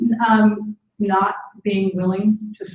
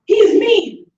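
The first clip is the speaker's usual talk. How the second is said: angry name-calling; 6 dB louder, much slower than usual. Shown as a demonstration. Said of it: "talking in abstract terms".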